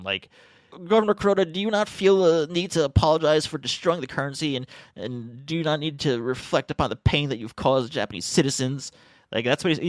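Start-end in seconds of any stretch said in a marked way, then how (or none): 0.9-8.9 high, loud, hyper-emotional reporter voice; cartoonish emotional reporter. Fringe reporter grilling Governor Kuroda, hammed-up high hyper-emotional plea. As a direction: ham up an overwrought fringe reporter. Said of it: "almost cartoonishly hyper-emotional tone".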